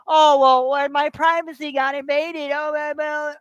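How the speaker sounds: sneering parody of a whiny Facebook user; mocking whine. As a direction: mimic them mockingly like they're fake-hurt. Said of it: "you wanna act like".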